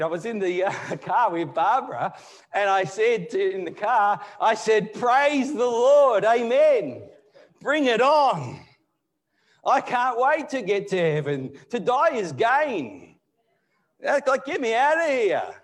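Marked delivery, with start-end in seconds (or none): 4.9-15.5 loud, high-pitched jubilant cry; drawn-out, fervent rejoicing; exultant. Retold or imitated. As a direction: proclaim it like a rousing amen. Acts out his jubilant reply in car.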